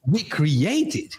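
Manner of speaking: slow, deliberate stress on "create"; "create" drawn out as the corrected word; emphatic correction. Real, not acted. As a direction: insist on "create", stretching the word out. Repeats correction of the host.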